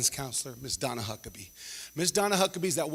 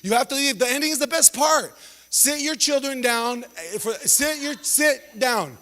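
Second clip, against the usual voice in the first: pleading host; 7 semitones higher than usual. Retold or imitated. Host begging the parents to stay, high pleading. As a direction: beg the crowd to stay put, pitched up.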